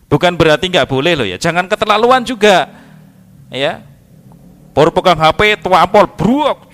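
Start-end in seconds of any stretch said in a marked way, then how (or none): 5.6-6.7 loud, drawn-out impact words; punchy acted-out crash; mimicked smack and crash. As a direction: stretch out the slam, high and loud.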